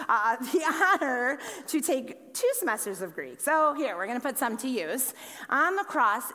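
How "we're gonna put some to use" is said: ordinary talk, nothing marked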